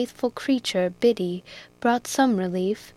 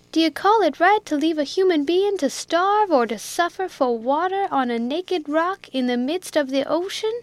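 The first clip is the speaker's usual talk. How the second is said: higher, outraged plea; indignant Jack; 7 semitones higher than usual. A narrator's reading. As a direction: press the cruelty home.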